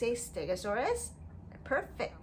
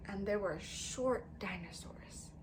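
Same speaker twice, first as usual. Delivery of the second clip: a soft whisper for the small ones; hushed storytelling whisper; 3 semitones lower, 7 dB quieter than usual. Acted. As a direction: drop to a secret whisper. Whispering small thing to kids.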